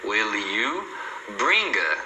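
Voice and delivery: WILL YOU and BRING A as joined chunks; "wil-lyou", pause, "bringa"; linked words. Shown as a demonstration. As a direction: carry L into "you", then run "bring" into "a". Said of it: "emenda com o you".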